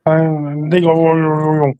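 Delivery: lightly attempted Chinese voice, a bit low; rough mock Chinese accent. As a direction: imitate Chinese with babbled syllables. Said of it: "can you do a Chinese voice".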